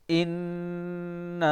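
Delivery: اِنَّا with the نا drawn out long; long madd on na. Shown as a demonstration. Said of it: "نا کو کھینچنے کی وجہ اس کے اوپر یہ مد ہے".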